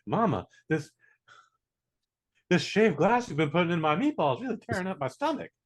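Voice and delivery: lightly voiced son with a bellyache; imitating ailing son. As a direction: complain to mama, lightly put on. Plays son complaining to mother.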